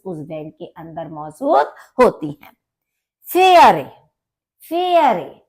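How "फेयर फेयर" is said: फेयर said higher and louder, showing the umlaut sound; umlaut demo. Shown as a demonstration. Teacher shows students the umlaut vowel, clear and emphatic. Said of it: "ए के ऊपर उमलाउट है".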